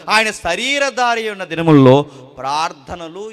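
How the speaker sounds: loud, high, fervent preaching; full-force preaching. Acted. Preacher thundering to the congregation about fear, loud and fervent. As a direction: drive the point home to the congregation.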